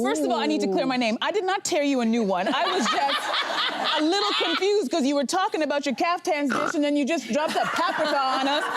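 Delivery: normal talking voice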